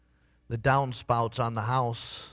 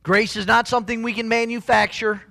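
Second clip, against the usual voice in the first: higher, louder, slowing into the build; full-force preaching; 7 semitones higher, 7 dB louder than usual. Acted. Pastor lifts his voice to the congregation, fervent preaching.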